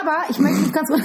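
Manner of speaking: barely holding back a laugh; laughter bubbling through the words; stifled giggles. Real, not acted. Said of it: "ich darf nicht lachen". (unterdrücktes Kichern)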